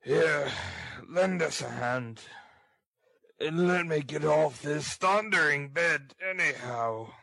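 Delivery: surly ruffian's character voice; grumpy, demanding robber voice; ill-tempered robber. Acted. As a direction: order it irritably as a bedridden brute. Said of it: "Illness had not improved Mister Sikes's temper".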